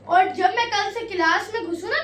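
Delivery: normal talking voice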